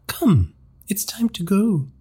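high, slow, luring voice; seductive. A narrator's reading. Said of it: "she said seductively".

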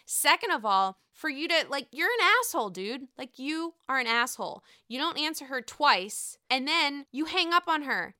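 outraged fan scolding a selfish husband; angry rant. Real, not acted. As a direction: scold him hard like he's right there. Ranting at Michael.

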